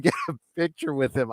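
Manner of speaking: ordinary delivery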